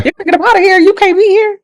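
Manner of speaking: high, loud, acted-out protest; retold indignant eviction. Retells throwing the man out.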